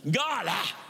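mock preacher's "God-ah"; affected "ah" prayer. Mimicking ministers' prayers, affected churchy voice. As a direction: tack a heavy "ah" onto the word. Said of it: "ah" at the end of everything".

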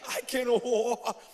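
mock awe, drawn-out ending; slow-dawning, hammed-up amazement; amazed paralyzed man. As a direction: imitate a man astonished to find himself healed. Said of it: "I can see that paralyzed man. He's like".

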